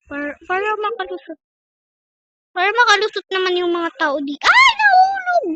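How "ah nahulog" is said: high, slow shocked wail; surprised cry. Real, not acted. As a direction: cry out as it drops. Food falls off in game.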